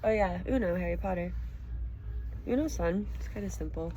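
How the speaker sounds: plain reading voice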